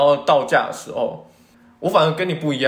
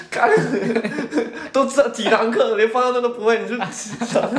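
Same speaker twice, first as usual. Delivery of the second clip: mock angry scolding; 8 semitones higher than usual. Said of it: "他口气是真的很凶的".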